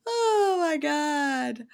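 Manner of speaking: slow, high oh my God; drawn-out disbelief. Real, not acted. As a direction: stretch it in amused disbelief. Reacting to absurd story.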